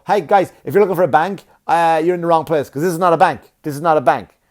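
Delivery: mock-helpful heckle at the old couple; snide call-out. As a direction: call across the lobby with a sneer. Calling out to arriving couple.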